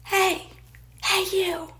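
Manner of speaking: high shout across a distance; calling out. Retold or imitated. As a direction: yell after someone running away. Pat calls after her running.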